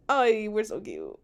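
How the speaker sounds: ordinary delivery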